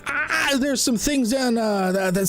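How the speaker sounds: high, stammering twitchy cat; a nervous cat tripping over every word. Acted.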